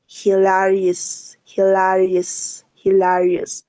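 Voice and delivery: "hilarious" stretched slowly, syllable by syllable; slow pronunciation drill. Shown as a demonstration. Teacher drills "hilarious" slowly for students. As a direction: draw "hilarious" out slowly for the class to copy. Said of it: "¿cómo pronunciamos?".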